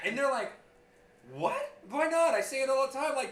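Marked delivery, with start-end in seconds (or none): 1.3-3.2 high, loud imitated kid; protesting kid. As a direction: voice a kid's high-pitched protest. Teller voices kid protesting.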